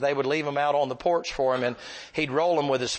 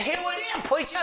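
very high put-on older man; imitated simple man, high and odd; Herbie. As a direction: hand it over in an eager high voice. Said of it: "He said".

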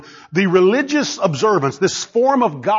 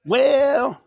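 higher mocking "well"; mock skeptic; 5 semitones higher than usual. Preacher mimicking skeptics with a scoffing tone. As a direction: scoff like a doubter. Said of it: "and they'll say".

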